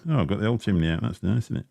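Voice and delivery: normal talking voice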